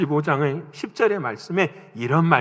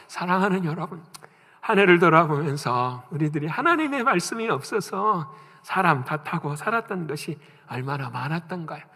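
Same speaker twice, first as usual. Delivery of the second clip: ordinary talk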